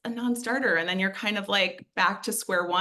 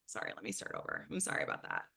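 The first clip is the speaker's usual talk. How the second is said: whispered apology; 10 semitones lower, 13 dB quieter, faster than usual. Real, not acted. Apologising while fixing screen.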